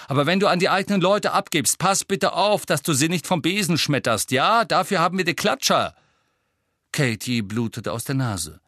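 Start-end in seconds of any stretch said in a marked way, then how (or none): 0.1-5.9 high, loud captain's scolding; barked telling-off. A narrator's reading. Angelina scolds Ron for hurting Katy, barked telling-off. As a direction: lay into the careless player. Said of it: "bellte Angelina".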